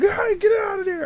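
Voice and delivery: high, loud shooing shout; reenacted yell. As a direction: yell to chase squirrels off a roof. Yelling at squirrels on roof.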